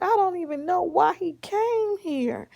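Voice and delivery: high-pitched puzzled granny; put-on high granny fussing; indignant grandma. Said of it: "And then she was like".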